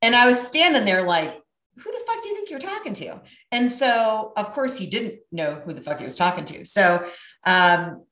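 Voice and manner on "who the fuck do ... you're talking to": high, hushed, rapid disbelief; retold under-the-breath incredulity; incredulous inner voice. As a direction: mutter it fast and high to yourself, as if appalled.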